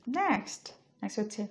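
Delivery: usual speaking voice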